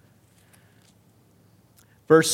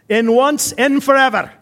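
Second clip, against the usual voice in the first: loud, raised-pitch mock Scottish usher; Scottish accent; 8 semitones higher, 6 dB louder, slower than usual. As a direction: fire it back in an attempted Scottish accent. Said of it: "That was my accent attempt".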